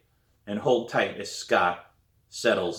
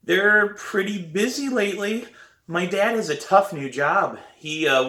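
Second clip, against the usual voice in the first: high, louder, anxious explaining; nervous excuse; 10 semitones higher, 5 dB louder than usual. A narrator's reading. Sam to principal about parents, high and nervous. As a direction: cover for the parents in a raised voice.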